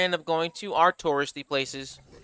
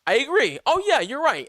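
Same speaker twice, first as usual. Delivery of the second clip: eager agreeing; 9 semitones higher, 4 dB louder than usual. Retold or imitated. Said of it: "It's just like".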